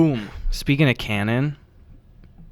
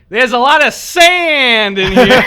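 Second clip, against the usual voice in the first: loud, high, drawn-out mock character voice; intrusive game announcer; 11 semitones higher, 8 dB louder, slower than usual. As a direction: pop in absurdly loud, stretching the words out. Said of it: "someone popped in and be like".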